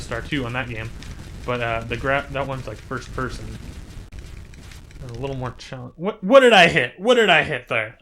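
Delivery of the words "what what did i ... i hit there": louder, startled protest; baffled outcry. Real, not acted. Gamer who just crashed into something, startled and louder. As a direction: blurt it out after a sudden crash.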